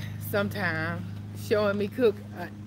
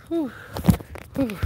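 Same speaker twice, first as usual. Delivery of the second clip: out of breath; 5 semitones higher than usual. Real, not acted. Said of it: "I was out of breath".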